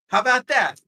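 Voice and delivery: high, loud mock-defiant question; acted challenge. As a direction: throw it out as a challenge. Acts out defiant challenge in recap.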